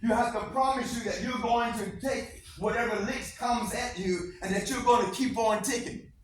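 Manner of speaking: normal talking voice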